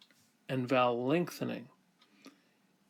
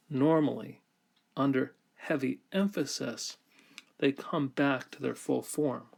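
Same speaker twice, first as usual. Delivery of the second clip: ordinary talk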